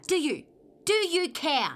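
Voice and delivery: loud, pointed questioning; angry challenge. Acted. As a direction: challenge loudly, pressing for an answer. Partner challenges in quarrel.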